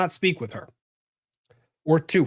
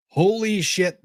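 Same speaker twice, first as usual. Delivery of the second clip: higher, stressed exclamation; stunned reaction; 6 semitones higher, 4 dB louder, slower than usual. Real, not acted. Commentator reacting to a risky open plea, stunned. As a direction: blurt it out in alarm at the risk.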